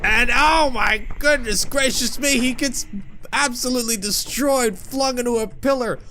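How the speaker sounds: excited. Real, not acted. Giant gets destroyed.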